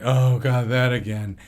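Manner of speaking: loud exasperated groan; mock-exasperated parent; weary parent groan.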